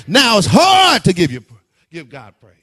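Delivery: usual speaking voice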